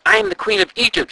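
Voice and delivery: high, loud furious roar. A narrator's reading. The queen facing the ghost, roaring in fury. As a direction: bellow your royal rank. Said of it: "she roared".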